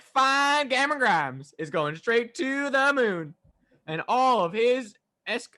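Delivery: put-on high, loud, slow Cameron Grimes accent. Hyping the wrestler to co-hosts, mock Cameron Grimes accent. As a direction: stretch it out in a hammed-up imitation of his accent. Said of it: "can't even say that in the accent".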